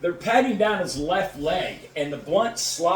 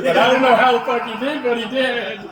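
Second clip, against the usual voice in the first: loud, high mock bafflement; imitated amazement; 6 semitones higher, 7 dB louder than usual. Billy telling her about the passed test, imitated loud amazement. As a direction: belt it out as the astonished friend.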